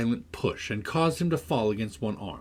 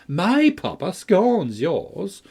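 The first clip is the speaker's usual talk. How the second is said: louder, higher, slow taunt; crying out; 8 semitones higher, 6 dB louder, much slower than usual. A narrator's reading. Linton taunting Cathy, loud and slow. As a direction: fling the insult at her. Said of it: "cried Linton".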